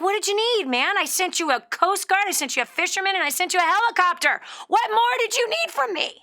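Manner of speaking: high, loud mock-incredulous retort; hammed-up God losing patience; acted exasperation. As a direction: list it off like you can't believe him. God answers the man, exasperated.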